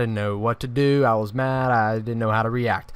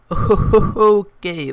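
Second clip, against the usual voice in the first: voice crack; 9 semitones higher, 8 dB louder than usual. Real, not acted. Telling the story to viewers, voice cracks sharply. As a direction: pitch cracks up high and loud on Okay. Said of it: "that voice crack right there".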